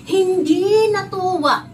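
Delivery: Hindi natuwa said slowly, high and loud; emphatic stress. Shown as a demonstration. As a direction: lean hard on Hindi natuwa. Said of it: "stresses key point for children".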